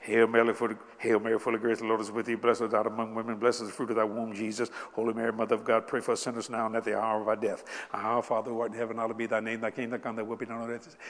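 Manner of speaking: low, rushed rote recitation; rattled-off prayer. Retold or imitated. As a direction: rattle it off by heart. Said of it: "Don't you say it real fast".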